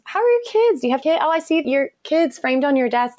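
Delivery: high-pitched, enthusiastic small talk; acted-out chirpy curiosity about someone's family; eager friendly questions. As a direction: pitch up, gushing with interest. Acts out her eager personal questions.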